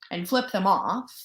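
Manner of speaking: usual speaking voice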